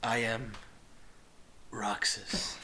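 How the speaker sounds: low, hushed whisper; a soft, low whisper for the big reveal. Acted. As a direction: breathe the name like a dark secret. Dramatic final poem line.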